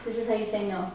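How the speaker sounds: usual speaking voice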